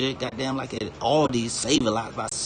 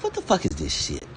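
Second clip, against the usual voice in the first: mock outrage; 6 semitones lower than usual. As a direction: squeak it out in mock shock. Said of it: "it was like".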